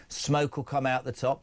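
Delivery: ordinary talk, nothing marked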